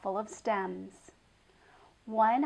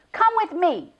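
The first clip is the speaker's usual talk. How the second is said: stern command; 9 semitones higher, 10 dB louder than usual. A narrator's reading. Dragging the goat by the horn.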